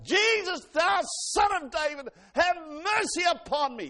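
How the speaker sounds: high, very loud desperate cry; top-of-the-voice yell. Acted. Bartimaeus crying out to Jesus for mercy, yelling. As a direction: cry out with all your might, words drawn out. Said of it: "He yelled at the top of his voice".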